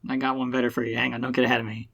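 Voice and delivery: ordinary delivery, nothing marked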